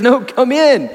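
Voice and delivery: high, loud, eager father begging his son to come in; pleading father. Retold or imitated. Father pleading with the elder son, high and eager. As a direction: coax him in like a delighted father. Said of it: "He says".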